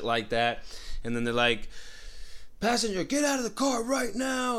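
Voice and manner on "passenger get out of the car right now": high-pitched shouted command; retold police yell. Cops with guns pointed yelling at the passenger, shouted order. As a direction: yell it like an armed cop. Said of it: "they're fucking yelling".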